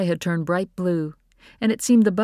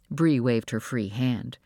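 ordinary delivery

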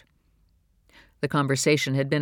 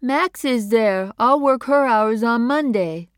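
put-on higher, slow, simple young woman's voice; Phoebe's voice; 9 semitones higher, 7 dB louder, slower than usual. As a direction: say it slowly and plainly, higher up. Phoebe explains to her mother.

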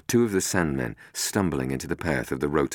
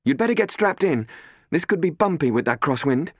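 higher, louder brisk warning; Robin, brisk warning; 12 semitones higher, 4 dB louder than usual. Acted. Robin warning the others to strap in, brisk. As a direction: warn them matter-of-factly. Robin warns while taking the seat.

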